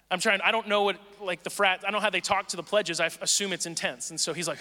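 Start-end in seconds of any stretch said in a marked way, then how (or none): none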